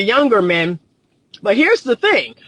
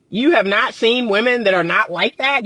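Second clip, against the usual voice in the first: ordinary talk, nothing marked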